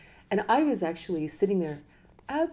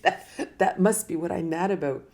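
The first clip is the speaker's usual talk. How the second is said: louder, laughing through the words; 5 dB louder than usual. Real, not acted. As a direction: laugh at yourself while saying it. Laughing at herself.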